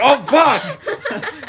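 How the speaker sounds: high-pitched pretend shock, laughing; hammed-up startled yelp; mock panic. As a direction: squeal it as if just spotting disaster. Imagining sister turning to see fire.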